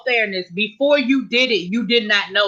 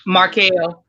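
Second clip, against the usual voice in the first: ordinary delivery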